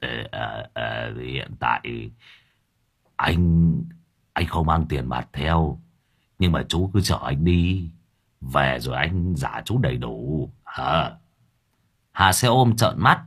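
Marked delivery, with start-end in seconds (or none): 0.0-11.1 put-on low, soft, hesitant old man; embarrassed mumble. A narrator's reading. Broke Lâm Thọt admits he has no cash, embarrassed. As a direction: stammer out an awkward excuse. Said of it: "vẻ mặt của lão ngượng ngùng".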